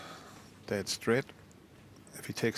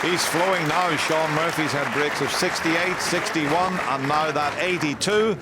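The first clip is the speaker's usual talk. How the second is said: loud, high, thrilled praise; excited commentary; 7 semitones higher, 6 dB louder than usual. Real, not acted. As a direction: sell the break like a big moment. Praising Murphy's eighty-two break.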